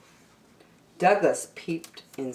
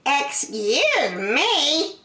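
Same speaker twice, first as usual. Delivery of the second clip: high, slow offended rabbit; high prim objection; indignant rabbit; 9 semitones higher, much slower than usual. Acted. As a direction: draw it out as a huffy rabbit.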